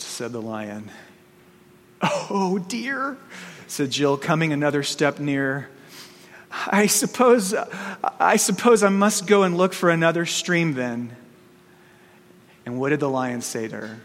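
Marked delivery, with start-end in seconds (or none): 2.0-3.2 put-on dismayed girl. Girl's dismayed sigh. 6.5-11.2 voiced girl. Girl answers the lion.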